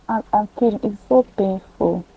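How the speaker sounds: hushed, private remark; muttered aside. Real, not acted. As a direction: drop almost to nothing. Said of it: "quiet aside".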